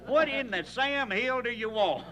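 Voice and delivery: high, fed-up shout back; boy hollering back at his father, fed up; exasperated yell. Retold or imitated. Exasperated retort yelled.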